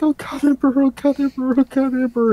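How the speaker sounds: very high, frantic repeated prayer; hammed-up panicked whimper; whimpering in terror. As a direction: whimper it over and over in a squeaky pitch. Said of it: "hear her whimpering and scream and going like".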